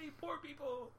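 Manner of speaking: high, soft mock cheer; sarcastic cheer. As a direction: fake celebrate it.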